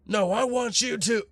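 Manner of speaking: high-pitched, heated comeback; acted angry retort. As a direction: fire back, voice jumping way up. Enraged player snaps back in story.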